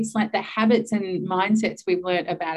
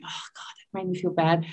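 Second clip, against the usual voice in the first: unvoiced whisper of regret; whispered guilt; 5 dB quieter than usual. Mom whispering her guilt after snapping at son. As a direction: whisper it to yourself, feeling bad. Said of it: "I'm like".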